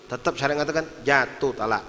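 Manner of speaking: usual speaking voice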